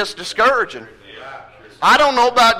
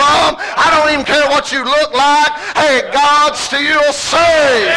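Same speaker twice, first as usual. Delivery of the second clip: building preacher's call, ending shouted; preaching at full force; 4 semitones higher than usual. Acted.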